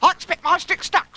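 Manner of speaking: very high little voice; high-pitched childlike voice; Roo. Acted. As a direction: squeal it like an excitable little one. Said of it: "said Roo".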